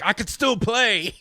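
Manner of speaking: high, drawn-out mock bravado; mock insisting player. Host mocking an injured quarterback, high put-on insistence. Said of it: "and also be like".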